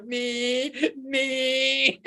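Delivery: high, taunting nee nee; a sing-song cosmic tease; mocking universe. The universe mocking him over the hat, a high taunting nee nee. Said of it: "the universe is going".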